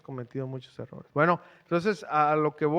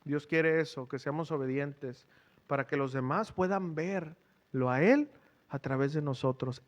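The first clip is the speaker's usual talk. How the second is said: ordinary delivery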